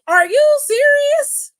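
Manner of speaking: high, loud, slow mock disbelief; loudly incredulous. Imitating a wrestler challenging his accuser, loudly incredulous. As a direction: stretch it out high and loud, appalled.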